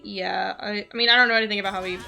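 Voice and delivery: ordinary talk, nothing marked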